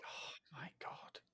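breathless whispered disbelief; stunned whisper. Real, not acted. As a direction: gasp it out under your breath, almost no voice. Reacting to Idris Elba mention.

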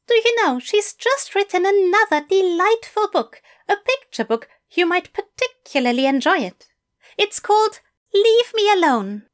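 high, eager English teacher; bright, keen invitation from a fussy gentleman. A narrator's reading. Fell voice.

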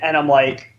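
ordinary talk

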